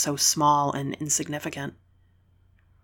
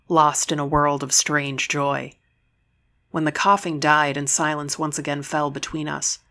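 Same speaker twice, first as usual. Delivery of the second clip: usual speaking voice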